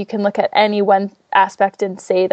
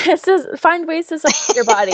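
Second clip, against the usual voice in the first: laughing; 8 semitones higher than usual. Real, not acted. Laughs at host's joke.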